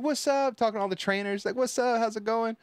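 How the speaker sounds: imitating girl's greeting. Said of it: "she's like".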